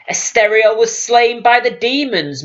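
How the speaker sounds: high, firm insistence; insistent. A narrator's reading. Queen insisting to the hidden voice, firm and high. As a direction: press the point, refusing doubt. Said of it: "Morothai insisted".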